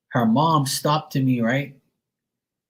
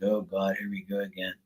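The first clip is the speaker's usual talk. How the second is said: much softer, resigned mutter; muttered under breath; 4 semitones lower, 11 dB quieter than usual. Retold or imitated. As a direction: sigh it to yourself, dreading what's coming. His inner groan.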